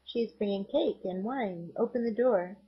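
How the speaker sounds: ordinary delivery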